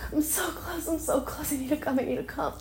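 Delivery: fast, high, breathy pleading; breathless. Real, not acted. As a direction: rush it out breathlessly. Breathless urgency.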